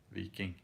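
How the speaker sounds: "Viking" said alone as VEE-king; preferred alternative pronunciation of Viking. Shown as a demonstration. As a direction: pronounce "Viking" with ee, not eye. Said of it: "I can start saying Viking".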